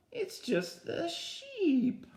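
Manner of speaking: very high, bleating hello; bleating sheep. Acted. The sheep asking the pigs to come in, high bleating. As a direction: bleat it sweetly at the door.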